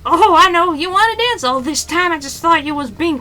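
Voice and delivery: hammed-up sailor brushing off the captain; high voiced sailor. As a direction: play the cheeky sailor, bright and loud. Voicing sailor's reply in game.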